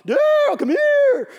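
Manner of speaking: very high, loud yelled coach caricature. Retold or imitated. Football coach calls over his nervous player, high hollered caricature. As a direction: bellow the summons way up high like a cartoon coach. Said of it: "that's how he talked".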